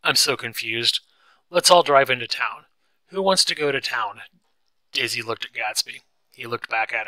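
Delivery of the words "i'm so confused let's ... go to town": loud, higher, agitated plea; worked-up, raised voice; upset, near tears. A narrator's reading. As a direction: blurt it out loudly, on the edge of upset. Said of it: "like she was about to start crying".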